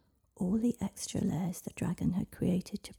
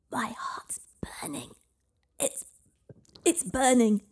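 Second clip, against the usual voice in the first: pained cry; 6 semitones higher, 6 dB louder than usual. Acted. Dragon in pain from lye.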